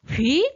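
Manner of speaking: 휙 sweeping up from mid to high; second tone. Shown as a demonstration. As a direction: let 휙 shoot upward like a rising question. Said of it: "이성". (휙을 위로 확 올리며, 이성으로)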